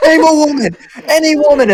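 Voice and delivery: high-pitched, loud mimicking yell; imitated scream. Friend re-enacting the screaming guy from the video, high imitated yell. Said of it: "he screams".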